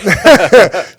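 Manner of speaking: a real laugh after calling himself a delinquent; loud laugh. Real, not acted. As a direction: burst out laughing at yourself.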